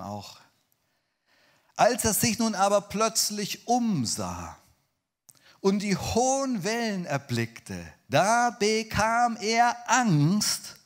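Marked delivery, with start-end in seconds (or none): 1.8-10.8 high, loud, drawn-out Bible narration; dramatic scripture reading. A narrator's reading. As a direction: proclaim the verse slowly and forcefully.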